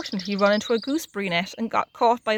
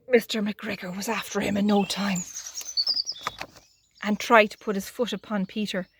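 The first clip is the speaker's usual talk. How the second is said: usual speaking voice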